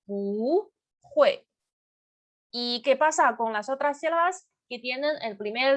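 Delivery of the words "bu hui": "bu" rising, "hui" falling sharply; bu goes up. Shown as a demonstration. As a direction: push "bu" upward, then drop "hui".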